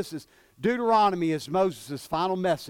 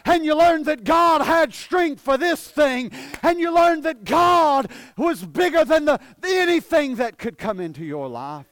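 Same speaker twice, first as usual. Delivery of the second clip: loud, high preacher's cry; full-force preaching; 8 semitones higher, 6 dB louder than usual. Real, not acted. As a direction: preach it hard, easing off at the very end.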